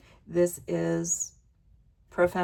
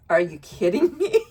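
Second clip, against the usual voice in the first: laughing disbelief; 3 semitones higher, 6 dB louder than usual. Real, not acted. Surprised by the surrender card.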